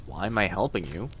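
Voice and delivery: ordinary talk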